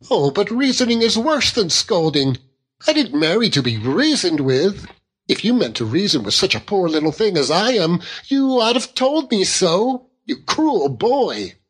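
put-on high-pitched petulant young wife; childlike wife, wounded. A narrator's reading. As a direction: protest like a hurt, spoilt child.

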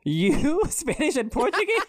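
high laughing disbelief; laughing surprise. Real, not acted. Host reacting to cohost's ancestry, high laughing surprise. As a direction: squeal it through a laugh.

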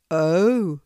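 a touch taken aback; a little surprised. A narrator's reading. Hedgehog reacts to the squirrel's answer, a little surprised. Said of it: "exclaimed Horace, a little surprised".

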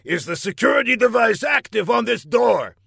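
high, forceful villain demand; impatient villain boss. A narrator's reading. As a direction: grill the underling loudly.

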